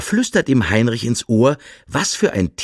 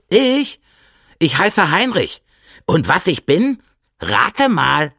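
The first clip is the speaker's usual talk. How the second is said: much higher, playful hare voice; teasing hare; 7 semitones higher than usual. Acted. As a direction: tease him in a high little hare voice. Hare answers the bear.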